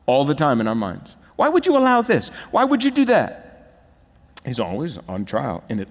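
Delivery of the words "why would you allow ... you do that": high pleading complaint; accusing God. Retold or imitated. Preacher voicing a sinner questioning God, high and aggrieved. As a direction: demand an answer, aggrieved.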